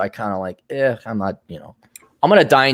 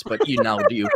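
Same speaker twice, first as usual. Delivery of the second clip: usual speaking voice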